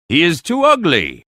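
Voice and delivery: a mild petulant objection; protesting. Acted. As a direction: push back like a spoiled princess. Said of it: "she protested".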